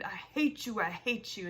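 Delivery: contained, emphatic fury; mild narrated scream. A narrator's reading. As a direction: read the screamed hatred without fully screaming. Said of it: "Rowdy screamed".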